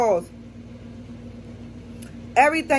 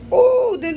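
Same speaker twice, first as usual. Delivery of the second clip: heat cry; 14 semitones higher, 4 dB louder than usual. Real, not acted. Heat hitting.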